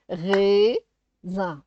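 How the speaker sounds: "Raisin" split into a long rising "rai", pause, "sin"; syllable by syllable. Shown as a demonstration. Teacher sounding out syllables for a child, "Raisin" split in two. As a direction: break "Raisin" into two stretched beats. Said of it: "de faire les syllabes".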